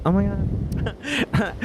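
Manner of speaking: starstruck gasp. Retold or imitated. Starstruck at work.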